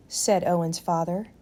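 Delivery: ordinary delivery, nothing marked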